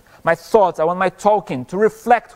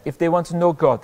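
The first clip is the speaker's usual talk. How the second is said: normal talking voice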